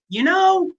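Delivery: high, silly quack-doctor voice; wackadoo doctor. Retold or imitated. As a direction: ham up a clueless quack pitching mercury. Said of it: "some wackadoo doctor is like".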